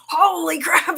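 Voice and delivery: loud high mock yell as her son; retold scream. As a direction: yell it like a startled kid. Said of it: "So he screams".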